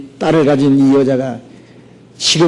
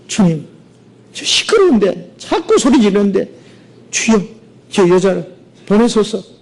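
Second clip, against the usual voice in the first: pleading disciples; 5 semitones higher than usual. Acted.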